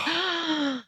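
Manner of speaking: long, soft gasp; retold gasp. Candidate retelling the call that stunned her, acted-out gasp. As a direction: suck in a high, hushed breath. Reacting to the astronaut's name on the call.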